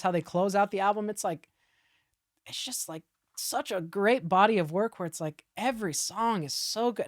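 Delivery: ordinary delivery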